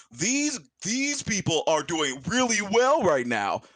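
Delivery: cheerful barkeep praising the band; Stonehill's voice. Acted. Tavern keeper tells the party about the band, lightly voiced. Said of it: "Stonehill's like".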